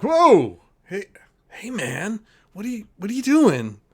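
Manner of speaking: high, loud startled yelp then protest; startled shout. Acted. GM voicing the startled man in the turret, high alarmed shout. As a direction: jump out of your skin and call down. GM voices startled NPC Mick.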